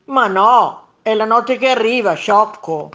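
loud, scornful monster retort; irritated contradiction. A narrator's reading. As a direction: snap back loudly at a fool. Second monster contradicts and insults. (ribatte forte, seccato)

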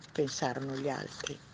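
plain reading voice

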